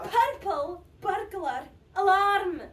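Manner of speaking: "Purple burglar alarm" slow and loud, every R rolled; Scottish with welly. Shown as a demonstration. Showing the English how it's done, emphatic rolled-R Scottish. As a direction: trill each R in "Purple burglar alarm", word by word. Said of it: "Put some welly behind them".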